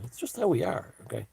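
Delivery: camp aside. Pretend.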